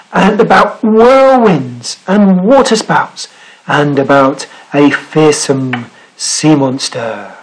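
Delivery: animated read-aloud, stretching key words; story narration. Acted. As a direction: draw listeners into an exciting tale. Reads picture book aloud.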